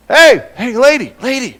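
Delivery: high, loud mock call to the lady; impatient calling out. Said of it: "he's going".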